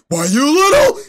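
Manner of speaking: loud, high cartoon dad outburst; Homer Simpson. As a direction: erupt like an enraged cartoon father. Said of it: "where he goes".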